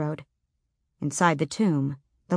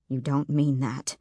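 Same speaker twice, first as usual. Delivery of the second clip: a hint of an ancient talking knocker, lower and softer; low door knocker voice; 4 semitones lower, 5 dB quieter than usual. A narrator's reading. Door knocker calmly rebuking Selena, in a low softer voice. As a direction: speak low and measured, like old bronze come alive.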